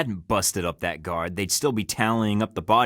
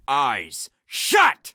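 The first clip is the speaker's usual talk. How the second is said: sharp command; 7 semitones higher, 4 dB louder than usual. Acted. Orders Darcy at gunpoint.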